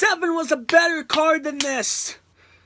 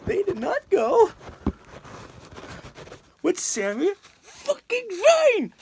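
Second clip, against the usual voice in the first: higher and slower, outraged near-yell; real angry outburst; 8 semitones higher than usual. Real, not acted. Fan furious at WWE for snubbing Sami Zayn, real outraged near-yell. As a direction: let the outrage boil over. Ranting about Royal Rumble pick.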